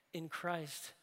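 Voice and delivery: ordinary delivery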